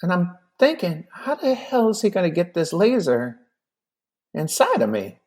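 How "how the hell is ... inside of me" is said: worried bewilderment. Retold or imitated. Puzzled, worried inner thought.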